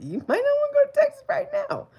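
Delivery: very high, loud singsong warning; high playful warning; high mock warning. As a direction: squeal it out like a teasing auntie. Teasing warning to a viewer.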